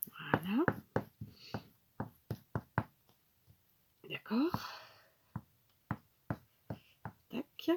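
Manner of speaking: sniffly, soft runny-nose voice; sniffling. Real, not acted. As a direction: sniff quietly between short words. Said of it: "Je renifle". (nez qui coule, reniflements)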